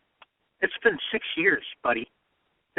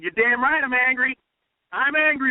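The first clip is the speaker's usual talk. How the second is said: raised, higher, punchy, owning the anger; defiant angry quote; 9 semitones higher, 3 dB louder than usual. Retold or imitated. Host quoting Trump's debate reply, defiantly angry. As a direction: shout it back like a proud firebrand. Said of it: "And Trump said".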